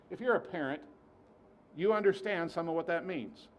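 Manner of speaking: normal talking voice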